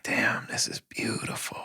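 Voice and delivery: slow hushed whisper, overwhelmed; awed whisper. Retold or imitated. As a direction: breathe it out softly, moved almost to tears. Moved to tears at a show.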